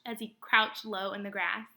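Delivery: ordinary delivery, nothing marked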